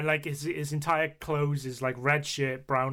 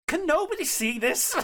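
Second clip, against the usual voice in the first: high, laughing incredulity; mock disbelief; 4 dB louder than usual. Mocking the film's clean-face gag, high disbelief. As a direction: ask it pitched way up in disbelief. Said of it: "everyone's just like".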